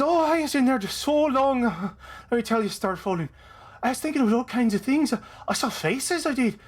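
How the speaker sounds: high, lilting Irish character voice; Irish-accented chatterbox. Acted. Biff chattering to the lantern he cradles, Irish accent. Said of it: "no, that's the voice".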